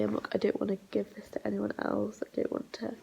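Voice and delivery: normal talking voice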